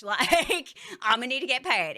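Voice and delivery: loud, high, laughing insistence; laughing loud repeat. Real, not acted. To chat, insisting she gets paid, loud and laughing. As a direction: belt it out through a laugh. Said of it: "repeats the demand loudly, laughing".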